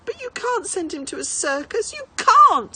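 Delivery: put-on a tearful boy begging; child crying out against parents; desperate protest. A narrator's reading.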